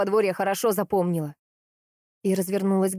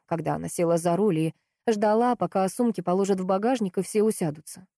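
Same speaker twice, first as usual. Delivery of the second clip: usual speaking voice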